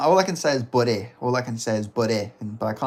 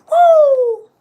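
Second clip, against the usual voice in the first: loud, very high whoop; Hamza Chimaev; 13 dB louder than usual. Acted. Imitating Hamza after his threat, loud high whoop. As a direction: cap the boast with a piercing whoop. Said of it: "the best I can get for a Hamza".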